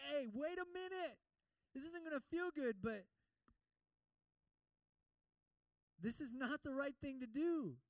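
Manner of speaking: urgent warning. Retold or imitated. Holy Spirit stopping someone on the path to destruction, urgent warning. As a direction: call out to stop someone heading into danger.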